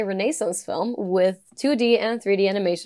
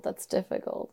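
whisper; 11 dB quieter than usual. Real, not acted. Torn over choosing a movie, whispered. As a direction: repeat it in a soft whisper. Repeats the words unvoiced.

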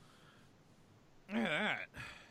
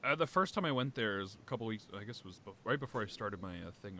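usual speaking voice

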